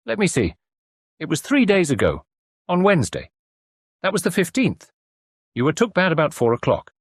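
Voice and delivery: cosy country landlady; kindly farm wife. Acted. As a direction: fuss over the patient kindly. Landlady character voice.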